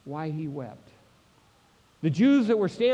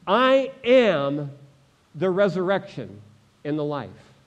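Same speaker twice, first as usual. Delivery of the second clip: emphatic proclamation; 5 dB louder than usual. Acted. Preacher voices Jesus answering Martha, emphatic proclamation. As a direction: declare it firmly, easing down by the end.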